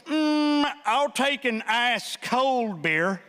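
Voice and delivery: slow, drawn-out beer order; lazy slow customer voice. Retold or imitated. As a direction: savour the words like a thirsty bro.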